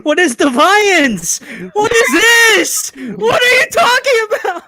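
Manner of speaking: rising disbelieving shouts, laughing through it; incredulous yelling. Real, not acted. Baffled, outraged about a name.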